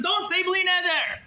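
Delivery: high, drawn-out mock yell. Congregant objecting to the Gabbai's blessing, yelling. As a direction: cut in with a high-pitched cry of protest. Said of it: "Todros yells at him".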